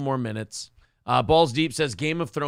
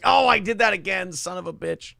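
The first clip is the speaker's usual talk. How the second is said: self-reproach; 6 semitones higher than usual. Retold or imitated. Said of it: "I'm not proud of it".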